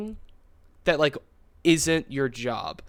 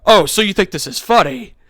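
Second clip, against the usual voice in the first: loud, higher-pitched mock indignation; retold outraged accusation, raised voice; offended customer; 5 semitones higher, 11 dB louder than usual. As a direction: imitate an angry customer's challenge. Retold offended customer confronts the clerk.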